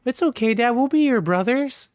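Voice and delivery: put-on high-pitched little-kid voice; imitating young sons. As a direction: pipe up like small boys consoling Dad. Young sons comforting their father.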